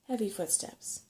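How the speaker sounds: ordinary delivery, nothing marked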